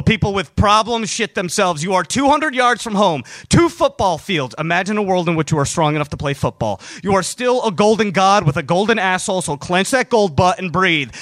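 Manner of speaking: plain reading voice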